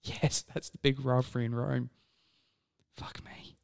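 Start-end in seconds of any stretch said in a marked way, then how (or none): 3.0-3.6 whisper. Real, not acted. Reacting to wrong quiz answer.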